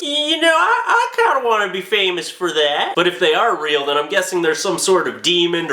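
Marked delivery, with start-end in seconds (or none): 0.0-2.9 sheepish fame-hungry voice with a laugh; fame seeker. Retold or imitated. Giggly voice of a would-be famous witness. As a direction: admit it with a giggle. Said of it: "the rest are just".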